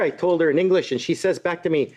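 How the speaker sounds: normal talking voice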